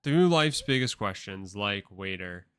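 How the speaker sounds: loud, emphatic announcer build, then easing off; podcast host intro. Acted. Host launching listeners on a journey, announcer delivery. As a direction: launch the show with mock grandeur.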